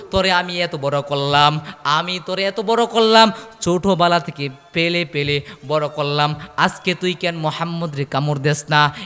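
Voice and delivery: ordinary talk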